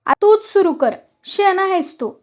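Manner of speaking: narrator's acted angry yell; irritated shout. A narrator's reading. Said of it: "केरू चिडून ओरडला".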